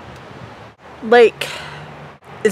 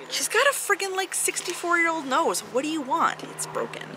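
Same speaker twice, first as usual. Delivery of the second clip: soft higher aside, ending in whisper; quiet aside; 8 semitones higher, 12 dB quieter than usual. Real, not acted. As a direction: mutter it so mom won't hear. Aside mocking mom's nose.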